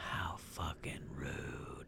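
breathy mock-offended whisper. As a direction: whisper it, slow and low.

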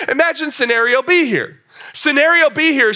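usual speaking voice

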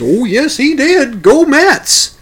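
high, loud, rising smug taunt; gloating taunt. Retold or imitated. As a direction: crow it triumphantly at the homeowners. Landscaper taunts rival fans.

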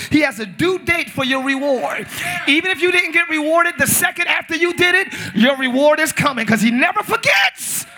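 loud, high, rising preacher's cry; building, shouted sermon climax; preaching at full force. Real, not acted. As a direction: preach it to the rafters.

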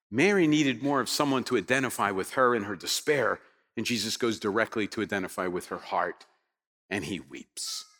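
low, soft and sinking almost to nothing; hushed compassion. Real, not acted. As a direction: let the grief quiet your voice. Telling of Jesus sharing Mary's grief.

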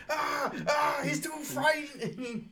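high, slow, shouted whimper; high slow frightened kobold shout; scared kobold. Acted. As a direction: wail it like a terrified little creature.

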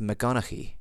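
"McGonaghy" said fast, with a guttural G from the back of the throat; Gaelic pronunciation, fast. Shown as a demonstration. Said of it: "if you just say that fast".

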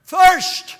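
a dying man's parched outcry; anguished cry. Retold or imitated. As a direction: cry out from the cross. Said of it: "And he cried out".

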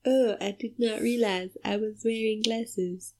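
softly mocking the movie character; a hint of a dopey Frenchman; quiet mocking character voice. Mock line for the character.

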